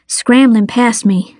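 usual speaking voice

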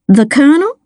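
hesitant question, rising at the end. A narrator's reading. Miss Lana answers the detective, hesitant. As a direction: stall with a questioning echo. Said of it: "Miss Lana hesitated".

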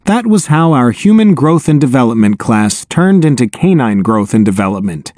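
ordinary delivery

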